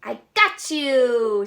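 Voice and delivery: triumphant duck. Acted. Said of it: "said the duck".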